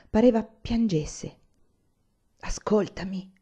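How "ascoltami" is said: hushed, half-whispered plea; pleading. A narrator's reading. Olì to angry Anania, hushed pleading. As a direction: beg softly for a hearing. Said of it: "disse Olì animandosi".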